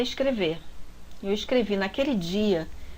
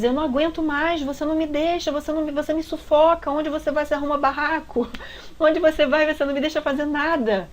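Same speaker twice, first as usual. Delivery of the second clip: high, loud exasperated yelling at her; fed up, push her away with your voice; angry boyfriend shouting; 7 semitones higher, 3 dB louder than usual. Retold or imitated. Boyfriend yelling at the kneeling girlfriend in the street, high and loud. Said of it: "Ele gritava".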